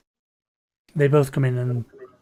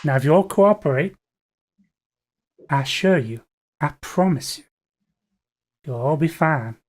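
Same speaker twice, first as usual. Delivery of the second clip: a Southern gunman soothing a room he's holding up, in a thick Southern drawl; heavy southern drawl; 4 semitones higher than usual. Acted. Heist leader calming the auction crowd, heavy southern drawl. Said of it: "very sort of heavy southern drawl".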